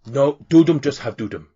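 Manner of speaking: lightly voiced cheery half-orc in broken Common; a hint of a jolly orc bull-handler. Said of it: "another half orc".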